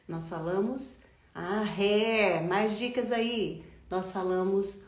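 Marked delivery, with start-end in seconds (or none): none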